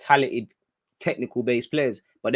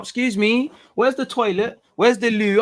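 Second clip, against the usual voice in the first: higher mock posh English accent, asking for the loo; a put-on fancy English accent to sound smart in America; 9 semitones higher, 5 dB louder than usual. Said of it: "I'll be acting up".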